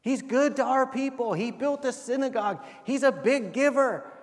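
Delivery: high, pleading voice of the elders; earnest pleading elders. Retold or imitated. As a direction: beg Jesus on the centurion's behalf, pitched high. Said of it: "they pleaded earnestly with him".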